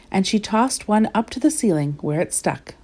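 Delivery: ordinary delivery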